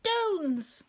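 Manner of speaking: put-on very high baby squeak; tiny high toddler shout; little voice. A narrator's reading. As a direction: call it out high like a toddler at the door. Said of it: "a little voice say".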